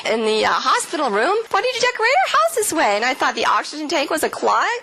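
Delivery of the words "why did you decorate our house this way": very high, fast mock complaint; hammed-up high-pitched protest; indignant bafflement. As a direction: re-enact a bewildered complaint, pitched way up. Re-enacts confused complaint after coma.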